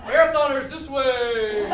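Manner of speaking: loud, drawn-out [name] impersonation; [name] impersonator. Retold or imitated. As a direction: lightly do a slow [name], calling out directions. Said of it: "there's an [name] impersonator".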